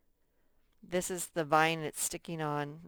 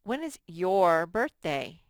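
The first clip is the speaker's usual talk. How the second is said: slow example question; 6 dB louder than usual. Shown as a demonstration.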